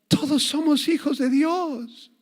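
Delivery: high, slow, sugary mock smile; smiling mock quote. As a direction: sing it out sweetly with a fake smile. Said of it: "con una gran sonrisa". (cita burlona, aguda y sonriente)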